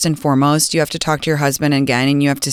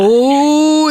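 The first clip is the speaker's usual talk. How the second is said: sharp gasp into a whispered ooh; gasp, then breathe out a knowing ooh; 9 semitones higher, 4 dB louder than usual. Real, not acted. Host hearing he's the youngest, gasping ooh.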